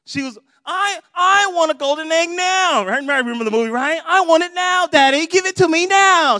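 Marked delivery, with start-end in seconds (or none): 0.6-2.9 loud, high, whiny bratty demand; Veruca Salt. Acted. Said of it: "kinda whiny". 4.1-6.4 loud, high, whiny bratty demand; Veruca Salt. Acted. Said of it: "She had a little British accent".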